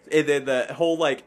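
usual speaking voice

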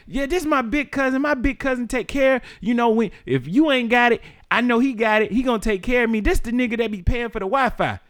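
mock cousin bragging. Cousin bragging to the boyfriend about her cousin, high mock voice. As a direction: brag about your big cousin.

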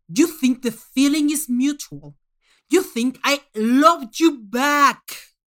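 loud, slow, taunting; loud mocking scorn. Manx mocking the contestant's love for him, loud and taunting. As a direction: sneer it down at her.

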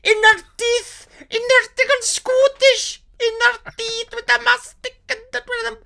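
very high, loud outraged rant drifting Scottish; Welsh ranting into Scottish. Retold or imitated. Snagging guy ranting as the accent slides Scottish. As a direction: lose it completely over bread and butter. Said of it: "It went Scottish".

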